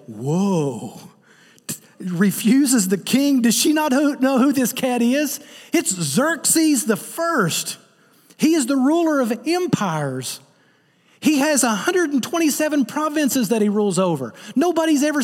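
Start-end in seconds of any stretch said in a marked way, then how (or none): 0.1-1.0 low drawn-out whoa of amazement; amazed whoa. Shown as a demonstration. Preacher shows the congregation a stunned whoa. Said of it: "now you're supposed to say". 1.7-11.6 mock outrage.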